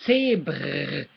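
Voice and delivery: "zebr" as TSAY-brrr, long uvular trill; trilled German R. Shown as a demonstration. Teacher showing the trill, "zebr" ending in rolled throat R. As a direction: hold the trill at the end of "zebr". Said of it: "you wanna trill".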